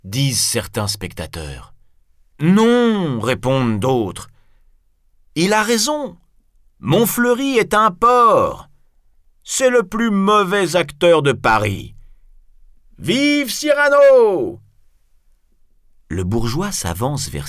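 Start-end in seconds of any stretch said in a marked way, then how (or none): 2.4-3.2 loud, high "no" from the crowd; high heckler's shout; shouted objection. Acted. Other spectators shout back. 5.4-14.6 high, loud heckling that ends in a cheer; raised, partisan spectator's cry; rowdy crowd shouting. Acted.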